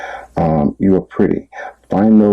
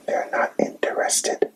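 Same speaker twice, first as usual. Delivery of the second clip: whisper; 10 dB quieter than usual. Real, not acted. Whispered answer to own question.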